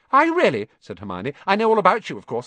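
put-on high, fast, know-it-all girl; very fast, bossy. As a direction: rattle it off without pausing for breath. Hermione voice continues.

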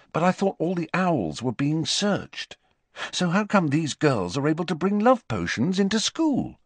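lightly voiced insistent boy; puzzled Harry, challenging. A narrator's reading. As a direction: press a friend with a puzzled question.

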